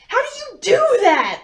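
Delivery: high, loud startled question; startled gasp. A narrator's reading. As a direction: blurt it out in shock. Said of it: "I gasped".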